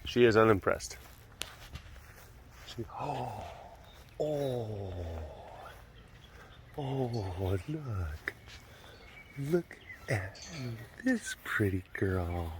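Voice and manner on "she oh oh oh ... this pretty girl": soft, lingering admiring coo; hushed admiration. Real, not acted. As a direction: coo softly over a beautiful cat. Admiring a cat he films.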